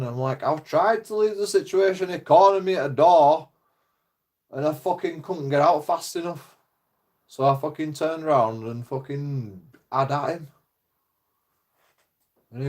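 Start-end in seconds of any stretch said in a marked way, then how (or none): 0.4-10.5 high, heated pleading; indignant protest. Retold or imitated. Young man arguing with police, heated and indignant. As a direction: defend yourself, worked up and exasperated.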